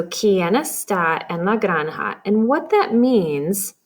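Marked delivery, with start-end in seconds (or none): 0.0-2.2 slow read-through. Shown as a demonstration.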